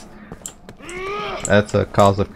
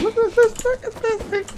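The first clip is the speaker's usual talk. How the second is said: squeaky high-pitched showing off; 6 dB quieter than usual. Pretend. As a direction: squeal it out in a tiny voice. Mock voice showing off magnum.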